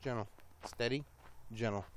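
ordinary delivery

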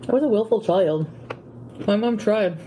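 talking while eating; words round a bite of food; mouth full. Real, not acted. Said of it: "Sorry, I'm being a pig".

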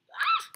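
loud, very high shriek; startled scream. Real, not acted. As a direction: shriek as the monster appears. Startled during scary game.

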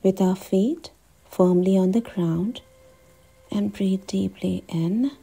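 spaced-out, measured relaxation voice; guided meditation. Acted. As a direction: lead a breathing meditation, unhurried, phrase by phrase.

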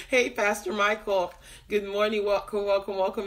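usual speaking voice

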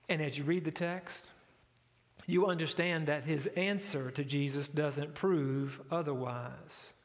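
usual speaking voice

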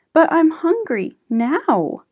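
slow, high, loud little bear complaint; whiny Baby Bear. Acted. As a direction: drag out the hunger like a small cub. Baby Bear whines for food.

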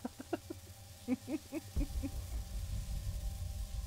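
long, mostly voiceless laughing fit; breathy giggling. Real, not acted. Player laughing after yelling at Lara, helpless giggling. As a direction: dissolve into wheezy laughter.

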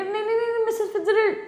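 high frantic pleading; panicked protest. Acted. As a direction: stop her in a flustered panic. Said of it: "Mrs फिट्जजेराल्ड जो उठती है".

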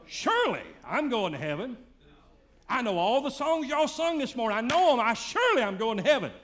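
high, loud mock of a self-assured churchgoer; smug churchgoer. Preacher mocking a complacent church member, high and loud. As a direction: lampoon the pew-sitter who is sure he is saved.

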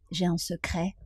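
quiet, secretive admission; hushed secret. Acted. Child narrator confiding "un secret", hushed. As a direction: softly confide it, a little low.

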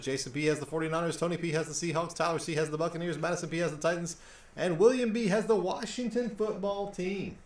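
quick, high bingo calling; bingo caller. Pretend. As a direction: rattle off names like a bingo hall. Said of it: "get out of bingo calling mode".

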